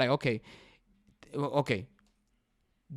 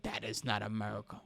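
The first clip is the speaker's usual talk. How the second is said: softer, a notch lower, sneering mimic; mocking the president; 4 semitones lower, 8 dB quieter than usual. Podcaster mocks the president's complaint, soft and sneering. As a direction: lightly mimic a sour dismissal. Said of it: "And you are going out there like".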